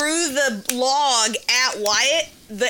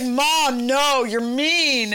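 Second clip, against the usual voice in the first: slow, drawn-out mock whine; imitated fussy whine; 3 semitones higher, much slower than usual. Mom imitates upset son refusing a photo, whining. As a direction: stretch it out like a sulking kid. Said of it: "he's still fussing about it".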